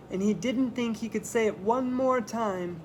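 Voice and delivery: usual speaking voice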